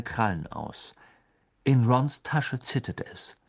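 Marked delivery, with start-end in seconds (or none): none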